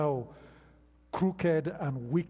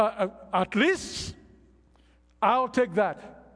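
loud, higher, surging sermon climax; full-force preaching; 5 semitones higher, 4 dB louder than usual. Acted. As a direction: hammer it home to the church.

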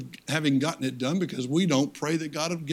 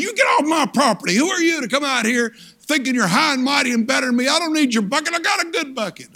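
much higher and louder, an indignant shout; angry old man; 9 semitones higher, 4 dB louder than usual. Retold or imitated. Old man shouting the boy off his property, indignant. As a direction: bark him off your land, offended.

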